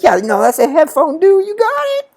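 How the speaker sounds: very high put-on gangster clerk; hammed-up gangster salesman, climbing into falsetto; mock gangster. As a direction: imitate a cocky clerk way up high. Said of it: "suddenly became very gangster".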